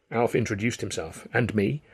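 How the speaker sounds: ordinary delivery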